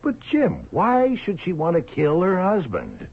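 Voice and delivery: high, incredulous objection; surprised protest. Acted. Partner doubts Jim's theory.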